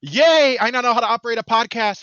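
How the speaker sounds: loud high cheer; excited cheer. Real, not acted. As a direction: celebrate like a small victory. Mock triumph at typing.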